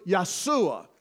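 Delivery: Yeshua as YAH-soo-ah; the Hebrew sound of Yeshua. Shown as a demonstration. Preacher giving the Hebrew form. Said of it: "In Hebrew".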